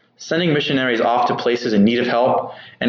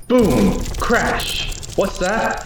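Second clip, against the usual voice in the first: dramatic exclamations; 7 semitones higher, slower than usual. Acted. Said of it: "Coleman writes".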